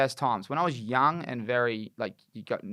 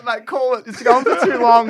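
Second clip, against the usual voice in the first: loud and high, urging through laughter; laughing urge; 12 semitones higher, 14 dB louder than usual. Retold or imitated. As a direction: hurry him up, loud and giggly. Urging mate to end prank.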